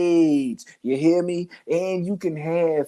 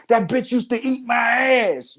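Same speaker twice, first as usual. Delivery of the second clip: higher-pitched mock outburst in an argument; blurting baby father; 5 semitones higher than usual. Baby father busting out mid-argument, mock blurted taunt. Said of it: "he just busts out like".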